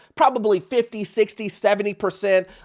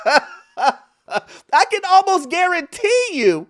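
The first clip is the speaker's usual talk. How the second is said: laughing, drawn out; 7 semitones higher, much slower than usual. Real, not acted. Repeats line while laughing.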